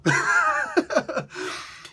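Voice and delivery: very high giggling laugh; high-pitched laugh. Real, not acted. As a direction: burst into a high laugh after the rant. Laughs after his own outburst.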